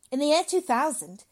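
high, loud, emphatic lecture; severe lecturing doctor. Acted. Doctor explains overpopulation to father, loudly emphatic. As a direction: declaim it with relish and force.

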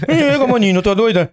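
high-pitched Paraense singer, mouth barely open; [name]. Retold or imitated. Impersonating a Paraense singer for his friends, mock Pará voice. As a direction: tease in a hammed-up Pará accent. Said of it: "Vê a [name], como ela fala".

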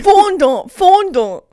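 "fondant" called out high and loud; mock exclaim. Friend repeats the word mockingly, high and loud.

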